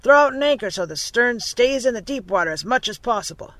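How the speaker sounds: loud captain's order; calling out. A narrator's reading. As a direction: shout instructions to the crew. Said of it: "he called".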